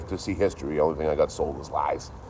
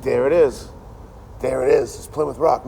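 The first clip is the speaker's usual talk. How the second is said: excited; 8 semitones higher, 5 dB louder than usual. Retold or imitated. Excitedly spotting the rock.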